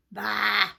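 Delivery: loud sheep baas; imitation of a bleating sheep. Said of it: "Sheep came along".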